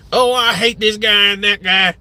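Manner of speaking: a lightly put-on hillbilly accent; mock hillbilly complaint. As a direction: grumble it like a hillbilly caricature. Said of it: "hillbilly voices".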